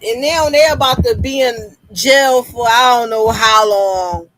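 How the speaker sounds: ordinary talk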